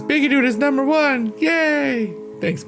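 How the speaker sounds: usual speaking voice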